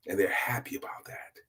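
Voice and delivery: soft whispered aside. A narrator's reading. Narrator telling of the well-fed room, whispered. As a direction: let it fall to a whisper.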